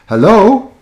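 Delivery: high, rising phone hello; checking the line. Real, not acted. Host picking up the next caller, questioning. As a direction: call brightly up into the line, questioning.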